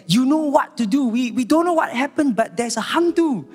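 high-pitched mock panic; alarmed. Sally's friend calling about a hantu, alarmed. Frantic caller retold by preacher.

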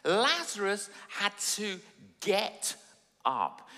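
ordinary delivery

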